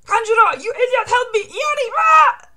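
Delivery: high, loud frantic shouting; panicked cry for help. Acted. Burning villain to his henchman, panicked high screaming. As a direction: shriek for help, ending in a long scream.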